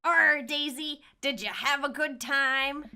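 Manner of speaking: pirate. Acted. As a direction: hail the kid like a hearty pirate. Pirate character speaks to child.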